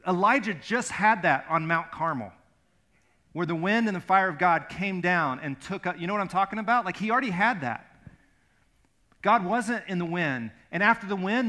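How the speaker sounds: loud, ringing sermon delivery; preaching at full force. Acted. Pastor preaching the Elijah story, loud and fervent. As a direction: drive it home to the congregation.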